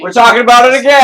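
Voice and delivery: high, loud, hyped-up cheer; excited shout. Real, not acted. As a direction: shout it with glee. Hyped return to favourite topic.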